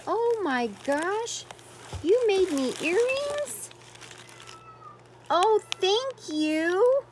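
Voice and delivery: high, loud delighted disbelief; surprised by handmade earrings, loud squeal; delighted surprise. Real, not acted. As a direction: gasp it out, unable to believe it.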